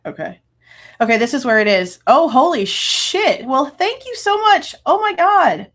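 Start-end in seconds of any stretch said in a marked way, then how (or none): 2.1-5.7 higher, louder, slow amazement; real overwhelmed surprise; stunned gratitude. Real, not acted. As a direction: react to a big gift, floored. Overwhelmed by donation.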